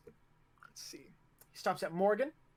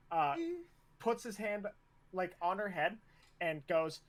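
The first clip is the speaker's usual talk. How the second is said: ordinary talk, nothing marked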